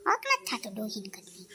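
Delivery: low, soft whispered grumble; whispered aside. Acted. Daughter-in-law grumbling about the old woman, whispered aside. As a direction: hiss it under your breath.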